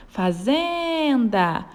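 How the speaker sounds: fazenda with ZEN stretched long; stress on ZEN. Shown as a demonstration. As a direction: call out fazenda, holding the syllable zen. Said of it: "que a gente falou com mais força". (fazenda com o zen forte e alongado)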